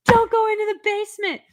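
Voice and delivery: high, loud warning shout; Don't do it! shouted way up high; yelling at the screen. Retold or imitated. Streamer warns the heroine off the basement, shrill-high loud shout. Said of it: "yelling at the prote".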